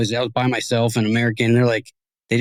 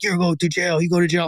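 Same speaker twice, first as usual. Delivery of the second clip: higher, foreign-sounding threat in broken English; threatening cop; 5 semitones higher than usual. Retold or imitated. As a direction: scare him like a crooked cop. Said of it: "He's like".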